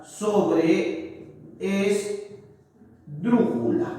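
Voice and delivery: "sobreesdrújula" very slow, in separate chunks; slowly broken-up word. Shown as a demonstration. Teacher naming the word class, slowly broken up. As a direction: say "sobreesdrújula" piece by piece with long gaps. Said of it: "Aquí está la mayor fuerza de voz".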